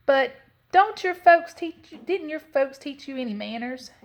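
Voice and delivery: high-pitched scolding from mama bear; stern. A narrator's reading. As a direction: scold a child about manners, starting loud. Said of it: "Mama Honey Bear said sternly".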